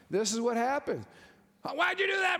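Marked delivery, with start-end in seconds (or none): 1.6-2.4 high, sharp complaint; mock angry whine. Husband yelling at home, high mock snap. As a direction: snap it like a family quarrel.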